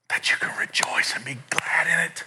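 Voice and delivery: soft, half-whispered wonder; quiet, intimate delivery; hushed emphasis. Real, not acted. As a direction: drop to a near whisper. Preacher drops to hushed emphasis.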